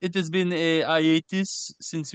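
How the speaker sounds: put-on thick French accent, drawn out. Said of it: "we doing French accents".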